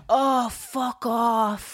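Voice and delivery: slow, drawn-out Irish brush-off; a lazy Irish "fuck off" done badly; mock Irish accent. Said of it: "sorry for my accent. It's terrible".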